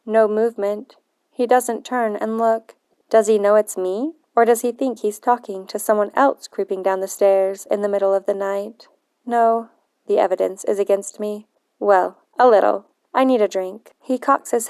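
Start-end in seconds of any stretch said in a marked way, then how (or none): none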